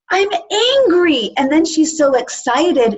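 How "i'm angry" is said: slow, higher, amazed announcement; delighted discovery. Retold or imitated. Amy Adams's character discovering anger, amazed. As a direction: savor the word like a new toy. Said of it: "And she goes".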